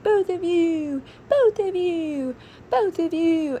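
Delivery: put-on high, drawn-out child's plea; imitated four-year-old. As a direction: wail it slowly like a small child put on the spot. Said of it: "four years old".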